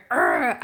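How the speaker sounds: a loud "Arrgh" hurled back at the troll; mock roar of retaliation; mock yell back. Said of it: "you wanna just go".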